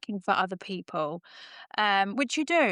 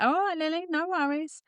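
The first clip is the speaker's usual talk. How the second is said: humouring reply; 8 semitones higher than usual. Retold or imitated. Lads humouring her.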